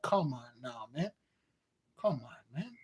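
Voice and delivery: low, soft, fed-up muttering; exasperated groan. Retold or imitated. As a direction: sigh it out, tired of the show. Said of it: "I'm like".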